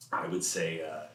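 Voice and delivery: ordinary delivery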